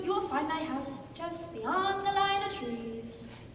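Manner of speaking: ordinary delivery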